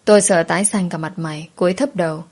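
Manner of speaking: ordinary delivery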